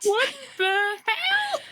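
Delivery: high, slow, disbelieving cry; shocked outcry. Real, not acted. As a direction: let the shock burst out. Friend reacts to shocking story.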